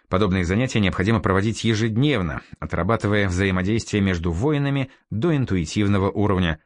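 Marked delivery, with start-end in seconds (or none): none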